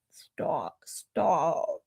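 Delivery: embarrassed whispered stop. Real, not acted. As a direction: whisper it, shyly waving it off. Brushing off a compliment, whispered.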